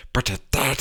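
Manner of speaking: ordinary delivery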